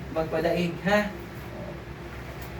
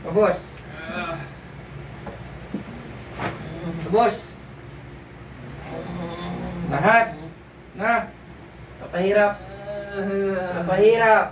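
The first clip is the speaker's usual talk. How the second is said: commanding exorcism; 2 semitones higher than usual. Acted. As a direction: drive the spirit out, command after command. Healer commanding spirits to leave.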